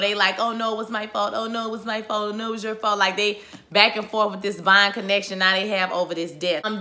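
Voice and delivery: ordinary talk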